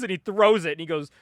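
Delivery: ordinary talk, nothing marked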